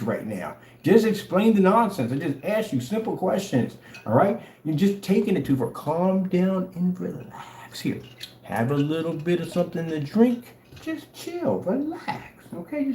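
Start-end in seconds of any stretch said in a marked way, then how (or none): 5.7-12.9 mock soothing.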